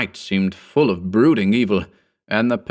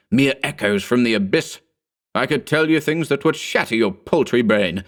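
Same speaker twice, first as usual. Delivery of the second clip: put-on higher, grandiose mad old sorcerer ranting; raving sorcerer; 4 semitones higher than usual. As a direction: rant like a madman boasting forbidden lore. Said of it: "when suddenly he cried".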